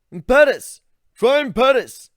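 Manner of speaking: yelling an idea across the smoky meadow; eager shout. Acted. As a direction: Ivan shouting his suggestion. Ivan shouting a suggestion.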